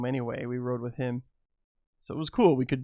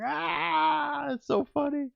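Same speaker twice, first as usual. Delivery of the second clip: much higher giggly imitation of himself; cracking up; 14 semitones higher, much slower than usual. Laughing in his wife's face after the story, in a high giggly voice. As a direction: squeak it out like you can't stop laughing. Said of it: "I cracked up in her face".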